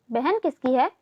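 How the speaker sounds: ordinary delivery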